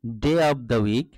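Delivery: very slow, clear English title; slow model reading. Shown as a demonstration. Teacher giving pupils the lesson title, very slow and clear. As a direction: stretch each word for the class to copy. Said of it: "teacher reads lesson title".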